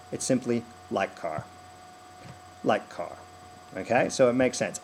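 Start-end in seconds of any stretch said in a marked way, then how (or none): 0.9-3.2 "like car", both words hit, falling at the end; key words only. Shown as a demonstration. Coach reducing the line to important words, stressed "like car". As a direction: hit "like", then let "car" fall. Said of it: "just say that the words that are important".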